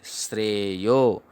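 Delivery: श्रेयो said slowly, with the conjunct श्र plain like श्रे in श्रेय; teacher slowly sounding out श्रेयो; slow syllable demo. Shown as a demonstration. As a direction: spell out श्रेयो slowly, श्र clearly joined. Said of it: "क्या पढ़ेंगे?".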